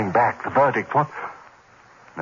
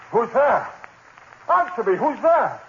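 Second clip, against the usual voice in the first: frightened call; 13 semitones higher than usual. Acted. Man in dark room calling to an unseen figure, frightened. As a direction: call out in terror.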